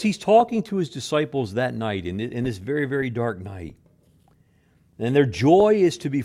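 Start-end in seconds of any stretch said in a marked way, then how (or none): none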